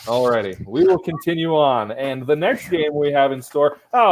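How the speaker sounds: normal talking voice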